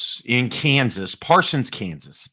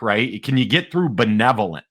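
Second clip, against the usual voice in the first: normal talking voice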